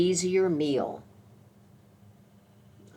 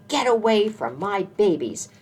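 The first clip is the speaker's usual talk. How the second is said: high, loud mama squirrel scolding; 5 semitones higher, 6 dB louder than usual. A narrator's reading. Mama squirrel driving a cat from her babies, loud scolding. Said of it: "no one could scold like old Mama".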